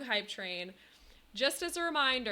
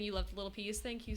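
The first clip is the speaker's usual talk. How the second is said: ordinary talk, nothing marked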